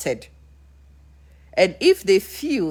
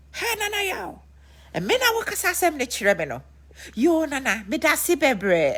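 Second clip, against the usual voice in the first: mocking Lumba; 10 semitones higher than usual. Lumba mocking Papa She, high sing-song. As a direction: dismiss him with fake thanks. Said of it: "And that is how Lumba said it".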